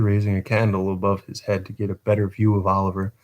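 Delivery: ordinary delivery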